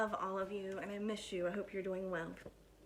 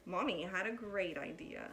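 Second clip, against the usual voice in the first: lively, animated picture-book reading; bouncy storytime voice with big swoops; reading to children; slower than usual. Acted. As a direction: read it like storytime for little kids. Teacher reads picture book aloud.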